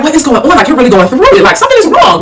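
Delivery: high-pitched retold bewilderment; alarmed disbelief. Daughter seeing mother looking basic, baffled. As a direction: act out noticing trouble, pitch jumping up.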